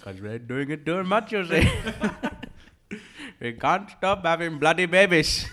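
loud, rising mock Indian accent. Joking about a sequel set in India, caricatured Indian accent. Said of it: "In India, it'd be District One thousand".

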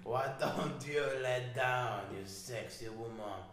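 slow mock-seductive French, breaking into laughs; French accent. Mock seduction for co-hosts, slow French accent. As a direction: woo slowly in a put-on French accent. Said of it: "you can do a French accent".

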